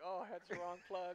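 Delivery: slow, laughing self-mockery; laughing dismay. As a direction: laugh at your own blunder. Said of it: "I'm like".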